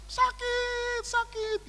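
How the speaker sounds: put-on high, thin child voice whimpering in pain; crying child's "sakit". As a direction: imitate the little girl crying it hurts. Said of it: "dia nangis".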